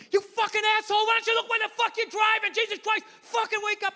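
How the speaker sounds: high-pitched irate pedestrian rant; imitated angry screaming. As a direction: act out a furious man yelling at a driver. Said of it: "He's screaming at me".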